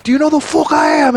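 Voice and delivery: very high, loud mock yell; mimicked entitled shout. Celebrity pulling rank on guard, mimicked angry shout. Celebrity's repeated outburst, acted.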